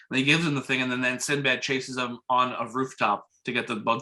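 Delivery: normal talking voice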